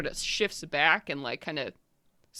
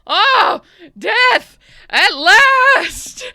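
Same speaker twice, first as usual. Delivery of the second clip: put-on much higher and louder, an old giant roaring with joy; yelling giant; 14 dB louder, much slower than usual. Old giant yelling at the party, joyful roar. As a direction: bellow it like a giant who longs for battle. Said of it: "brings the weapon around and yells".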